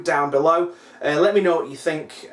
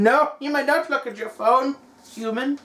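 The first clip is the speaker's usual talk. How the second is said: dog's voice; 6 semitones higher than usual. Pretend. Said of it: "He just gets in between".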